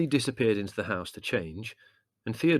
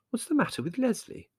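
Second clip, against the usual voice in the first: concerned mother. A narrator's reading. Worried mother asks after bellow.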